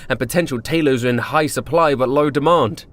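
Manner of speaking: ordinary delivery, nothing marked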